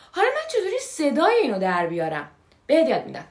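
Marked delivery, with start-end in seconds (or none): none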